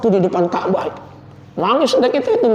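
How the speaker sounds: normal talking voice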